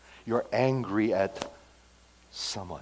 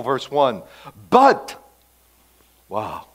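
normal talking voice

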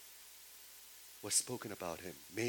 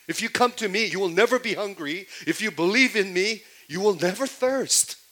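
higher and louder, proclaiming each promise; booming proclamation; 8 semitones higher, 9 dB louder than usual. Acted. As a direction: declare it like a prophet to a crowd. Preacher voicing Jesus' claims.

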